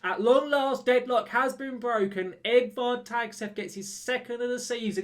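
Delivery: real excitement at finally taking the lead; excited goal call. Real, not acted. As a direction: cheer the breakthrough goal. Goal scored, celebrating the lead.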